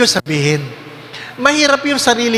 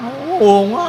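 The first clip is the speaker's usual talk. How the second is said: chatty agreement; 4 semitones higher, slower than usual. Retold or imitated. Imitating gossiping women.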